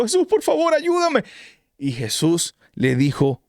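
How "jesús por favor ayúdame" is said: high desperate cry for help; frightened plea. Retold or imitated. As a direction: beg like a man sinking in the waves. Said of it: "Y se asustó mucho". (súplica aguda y asustada)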